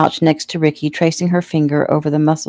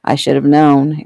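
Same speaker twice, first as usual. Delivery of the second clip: ordinary delivery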